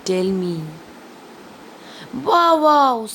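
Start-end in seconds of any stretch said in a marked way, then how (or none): 2.3-3.1 a hint of a barking dog, higher and louder; teacher playfully barks the dog's line; happy dog bark. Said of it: "says the dog happily".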